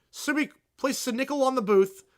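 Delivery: usual speaking voice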